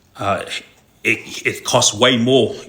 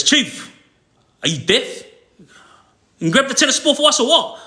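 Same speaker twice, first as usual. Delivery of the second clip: imitated yell, much higher, fed up; exasperated shout at guard; 11 semitones higher than usual. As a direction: call out, annoyed they won't move. Yelling at guards ignoring him.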